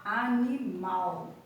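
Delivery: animal spelled out slowly, a-ni-mal with clear final L; teacher modelling a word slowly for the class; slow careful demo. Shown as a demonstration. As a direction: say animal slowly so pupils hear the L.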